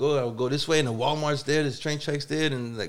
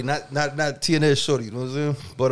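ordinary delivery

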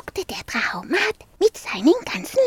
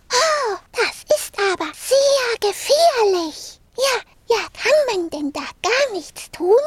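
put-on higher alarmed little girl voice; 8 semitones higher than usual. Marie worried about the fire flames, alarmed high voice. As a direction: ask anxiously as a small girl. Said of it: "Marie rief erschrocken".

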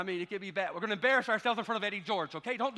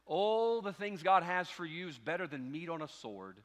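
ordinary delivery

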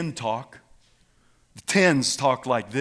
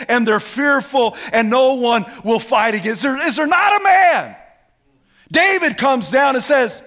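loud, raised preacher voice; full-force preaching; 9 semitones higher, 7 dB louder than usual. Real, not acted. Preacher telling the Goliath story, full-force preaching. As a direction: preach it big over the crowd.

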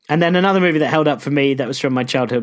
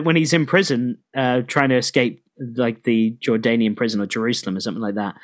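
ordinary delivery

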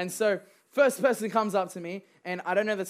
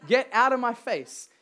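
firm command; 4 semitones higher, 6 dB louder than usual. Shown as a demonstration. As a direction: Get out of my face, louder and higher, no laugh. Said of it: "we need to be a people that say".

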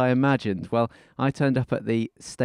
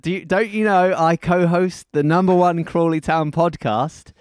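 mock self-important; 7 semitones higher, 6 dB louder than usual. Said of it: "I should have said".

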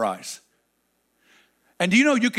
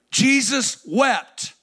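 Jesus wept stretched slowly, pitched higher; drawn-out emphasis; 5 semitones higher, much slower than usual. Acted. Preacher pressing the verse on the congregation, drawn out. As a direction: lean hard into every word.

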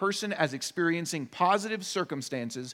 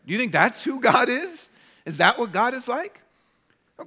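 ordinary talk, nothing marked

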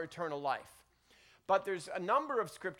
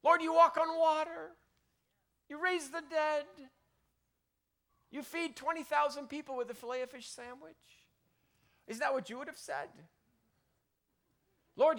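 higher, eager praise piling up; gushing imagined Peter; 6 semitones higher than usual. Retold or imitated. Peter imagined gushing over Jesus, high and eager. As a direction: flatter him like a starstruck fan. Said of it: "He would say".

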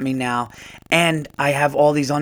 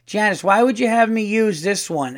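higher, mock-griping older cashier; playful whiny complaint to a coworker; exasperated old-school woman; 7 semitones higher, 4 dB louder than usual. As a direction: imitate a fed-up sitcom lady, pitched up. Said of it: "she goes".

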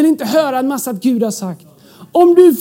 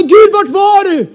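drawn-out, raised cry to God; loud complaint; 7 semitones higher, 6 dB louder, slower than usual. Acted. Believer demanding where God was, loud complaint. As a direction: call out in mock reproach, slowly.

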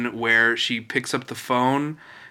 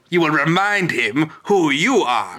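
higher-pitched imperious character impression; Edna; 4 semitones higher than usual. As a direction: command grandly in a put-on character voice. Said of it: "just my favorite Edna scene".

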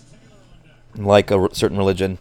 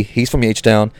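ordinary talk

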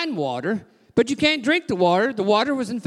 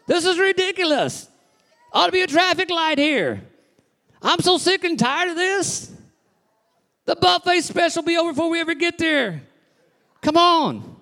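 impatient griping; 7 semitones higher than usual. Pretend. A churchgoer stuck in traffic after service, griping impatiently. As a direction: gripe like a hungry driver stuck in a jam.

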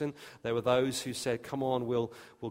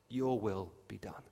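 lower, much softer voice of God; God's quiet verdict; 4 semitones lower, 6 dB quieter than usual. Acted. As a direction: let it fall low and quiet, as God. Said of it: "and those to whom God says".

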